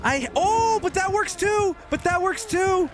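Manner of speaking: very high, excited squealing. Pretend. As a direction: squeal it with giddy glee. Gloating squeal after blinding killer.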